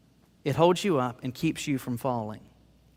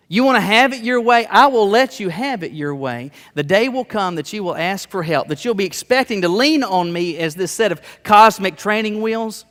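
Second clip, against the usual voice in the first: louder, higher, insistent voice of God; preached warning; 5 semitones higher, 7 dB louder than usual. Acted. Preacher voicing God warning the people, loud and high. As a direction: swell up and hammer God's warning.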